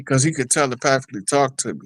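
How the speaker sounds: usual speaking voice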